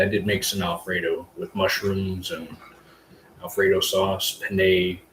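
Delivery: ordinary talk, nothing marked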